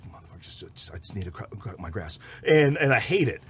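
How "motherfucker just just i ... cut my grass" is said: muttered curse at the rain, much softer; frustrated mutter. Retold or imitated. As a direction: grumble to yourself at the window.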